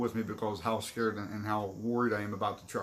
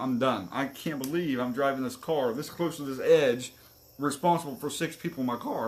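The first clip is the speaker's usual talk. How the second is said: usual speaking voice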